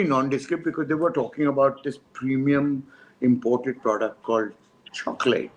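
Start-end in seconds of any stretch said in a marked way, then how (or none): 4.9-5.5 put-on British accent. Announcing the imported product in a British accent. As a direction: say chocolate the way a British ad would, low British accent. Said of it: "in this very British accent".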